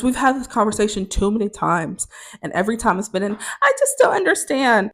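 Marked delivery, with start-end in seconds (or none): 3.6-4.9 high, whiny mimicry; mock a roommate playing helpless; put-on high, petulant voice; childish roommate. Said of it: "a child".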